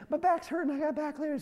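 imitating his wife in pain. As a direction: squeak it out like a hurting wife. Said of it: "And she's like".

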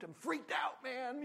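a high, loud freaked-out yelp. Retold or imitated. Spooked neighbor telling the pastor he is freaked out.

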